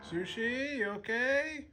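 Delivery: pet talk. Real, not acted. Cooing to the cat.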